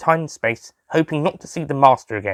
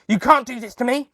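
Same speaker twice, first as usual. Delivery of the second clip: desperate plea; 12 semitones higher than usual. A narrator's reading. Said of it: "the master cried out".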